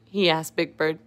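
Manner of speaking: usual speaking voice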